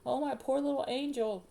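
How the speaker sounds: high, fussing sympathy; pitying mother. A narrator's reading. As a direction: pity your little one in a high voice. Mother pitying hurt child.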